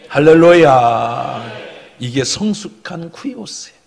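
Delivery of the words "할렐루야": loud, low, drawn-out hallelujah; preacher's call. Real, not acted. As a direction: proclaim it slowly and firmly. Preacher calls out after prayer. (낮고 길게 외치는 할렐루야)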